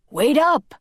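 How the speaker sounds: high, loud call after a friend; yelled. Acted. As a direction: shout it across the patio. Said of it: "Carlos yelled".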